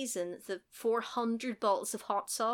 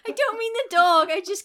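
high, loud, through laughter; real helpless laughing objection; laughing protest; 12 semitones higher, 11 dB louder than usual. Real, not acted. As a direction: protest while cracking up.

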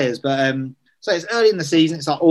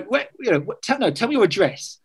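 higher, mock-interrogating voice; pushy manager; 7 semitones higher than usual. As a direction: demand it like a playful cop. Manager pretending to record him.

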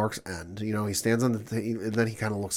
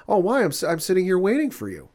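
pitched way up, baffled and defensive; incredulous high voice; 9 semitones higher, 4 dB louder than usual. Retold or imitated. Stood-up man protesting to his date on the phone, high and baffled. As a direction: squeak out a confused protest.

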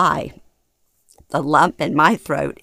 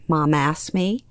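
ordinary delivery, nothing marked

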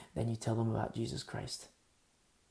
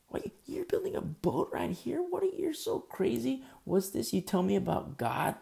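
mocking neighbor; 7 semitones higher than usual. Mockers taunting Noah.

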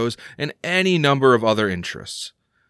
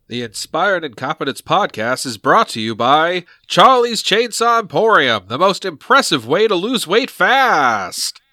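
booming, high, loud vintage radio announcer; old-timey announcer voice; 7 semitones higher, 6 dB louder than usual. Acted. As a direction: sell it like a grand old ballroom announcer. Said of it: "that old-timey announcer voice was perfect".

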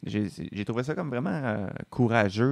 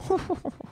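owl hoot. Retold or imitated. Imitating an owl.

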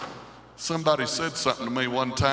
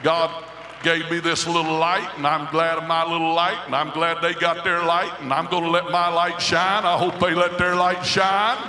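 loud, rising preacher's cry, pitched high; preaching at full force; 6 semitones higher, 5 dB louder than usual. Acted. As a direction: lift the congregation up with each line. Preacher voicing a believer's vow.

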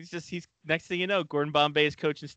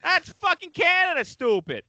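much higher, shrieking mock kid voices; hammed-up squeaky bickering; 14 semitones higher than usual. Boy called Kelly fighting with taunting kids, high shrill mock voices. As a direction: shriek it like a bratty schoolyard argument.

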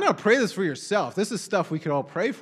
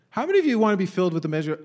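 ordinary talk

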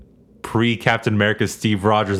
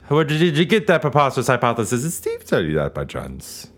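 mock villain line. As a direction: ask it like a scheming movie villain. Quoting a movie line.

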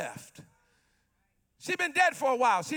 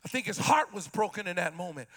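ordinary delivery

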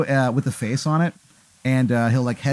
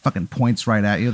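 ordinary delivery

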